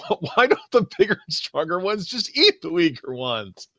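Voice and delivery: high, baffled whine; mock confusion. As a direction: wail in hammed-up bewilderment. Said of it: "I'm so confused".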